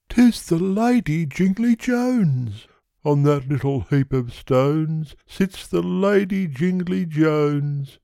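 high-pitched smitten little character; Yonghy Bonghy Bò. Acted. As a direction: pipe up high, drawing out the lady's name.

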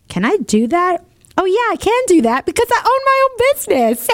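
rising eager thought; excited. Retold or imitated. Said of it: "I thought".

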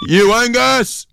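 very high, loud, slow drawn-out shout; imitated yell. Serbian fan behind them yelling at the player, loud and slow. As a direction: bellow the name like a gruff fan. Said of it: "he just yelled out".

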